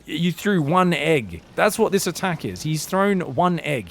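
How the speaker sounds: usual speaking voice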